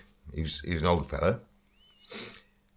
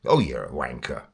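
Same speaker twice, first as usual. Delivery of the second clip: lower, half-hearted aggressive jab; mock aggressive; 8 semitones lower than usual. Shown as a demonstration. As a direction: try to sound aggressive but stay mild. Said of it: "that wasn't aggressive".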